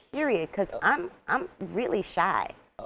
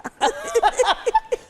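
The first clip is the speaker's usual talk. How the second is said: bright high-pitched laugh; 4 dB louder than usual. Real, not acted. Actor laughs at learning Hausa, high laugh. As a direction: laugh high at her own story.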